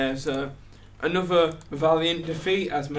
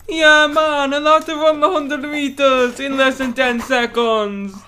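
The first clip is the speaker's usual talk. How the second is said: high, loud hammed-up Welsh accent, slow; 10 semitones higher, 8 dB louder, slower than usual. Host doing Accent of the Week for listeners, hammed-up Welsh accent. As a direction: overdo a Welsh accent, slowly. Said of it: "Accent of the Week".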